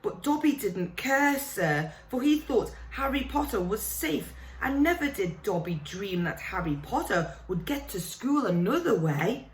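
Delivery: a fretful elf begging Harry to listen; house elf. Acted. Dobby the house elf pleading with Harry, high and anxious.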